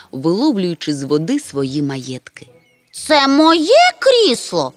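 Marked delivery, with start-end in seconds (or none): 2.9-4.7 high, loud, drawn-out cry; outraged shout. A narrator's reading. Big hemulen claims his chair back, shouting indignantly. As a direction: yell it in indignation. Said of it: "закричав великий гимуль".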